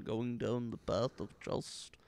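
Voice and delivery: soft, slow muddled singer impression. Joking to a friend after a slip, muddled singer impression. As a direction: lightly put on a slow, quiet rock-singer voice. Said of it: "your mix between [name] and [name]".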